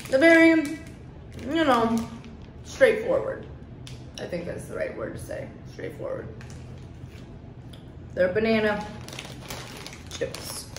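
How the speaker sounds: holding chip bag, chewing; talking while chewing. Real, not acted. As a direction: mouth partly full of food. Seen 0: ate a chip at -5; lips closed, jaw working between words.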